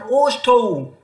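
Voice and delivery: ओष्ठौ chanted with falling close; teacher drawing out ओष्ठौ; sanskrit sutra word. Shown as a demonstration. Teacher reciting the sutra term to students. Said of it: "दंतः ऊपर के दांतों का स्थान".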